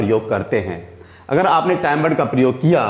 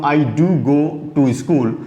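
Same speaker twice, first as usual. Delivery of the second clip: slow, with do leaned on; stress on do; much slower than usual. Shown as a demonstration. Teacher demonstrates the emphatic do to students, slow stress on do. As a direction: press the word do, slowly. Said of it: "ये थोड़ी सी गुस्से में बात हो जाती है".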